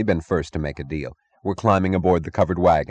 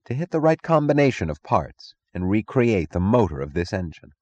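ordinary delivery, nothing marked

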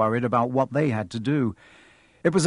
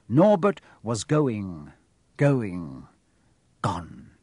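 fading away; 4 semitones lower, much slower than usual. A narrator's reading. Narrator watching Norbert vanish, slow fading finish. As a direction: let each word sink and fade.

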